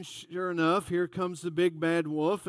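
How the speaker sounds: ordinary talk, nothing marked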